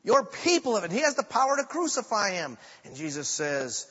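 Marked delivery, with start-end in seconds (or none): 0.0-2.6 higher, louder indignant retort; Pilate's outraged retort to the prisoner, higher and louder. Retold or imitated. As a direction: throw it back at him, offended. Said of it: "And Pilate is outraged".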